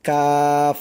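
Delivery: Arabic kaf said firm. Shown as a demonstration. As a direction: say কাফ crisp and firm. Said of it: "উচ্চারণটা করতে হবে শক্ত করে".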